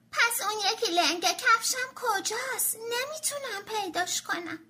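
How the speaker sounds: put-on high, whining child voice; whiny little donkey. Tommy complaining he can't find his shoe, whiny. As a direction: fret like a kid who lost his shoe.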